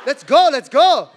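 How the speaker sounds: loud, slow, rousing call; rally the refugees like a caped hero; superhero rally cry. Pretend. Superhero urging the family onto the boats, loud and rousing. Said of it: "double cape superheroes".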